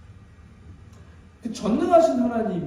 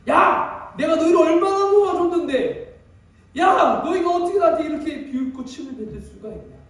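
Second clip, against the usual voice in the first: higher, louder indignant shouting; angry protest; 6 semitones higher, 3 dB louder than usual. Pretend. Jesus imagined shouting back at his mockers, furious. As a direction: yell back at the mockers in outrage. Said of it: "말할 수 있지 않았을까요".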